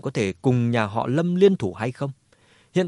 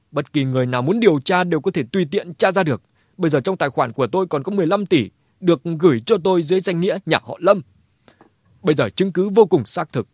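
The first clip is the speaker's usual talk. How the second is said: raised, fast, heated accusation; worked up, higher and louder; agitated shouting; 4 semitones higher, 4 dB louder, faster than usual. A narrator's reading. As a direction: pour out the accusation in high agitation. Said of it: "hét lên vô cùng kích động nói".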